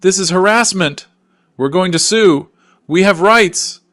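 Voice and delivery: high, very loud screamed threats; screaming. A narrator's reading. Daughter resisting police questioning, screaming in protest. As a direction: scream each protest at full volume. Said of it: "Grace keeps screaming".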